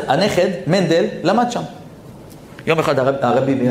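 ordinary delivery

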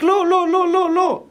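the mind shouts a frantic no, high and urgent; mind shouting no. Acted. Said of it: "השכל צועק". (השכל צועק לא בקול גבוה)

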